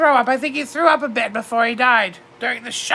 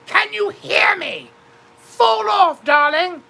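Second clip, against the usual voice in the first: high, mock-frantic screams of his name; a widow's high theatrical cry to her galloping husband; retold screaming; 8 semitones higher, slower than usual. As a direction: shriek at him to fall off the horse, hammed up. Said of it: "and I started screaming".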